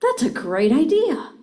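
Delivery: bright, high and loud, truly excited; enthusiastic. Shown as a demonstration. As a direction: sell the idea with real enthusiasm. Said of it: "I can say".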